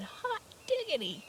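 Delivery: surprised exclamation. Shown as a demonstration. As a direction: shout it like you just got a great surprise. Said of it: "something people say when they are surprised".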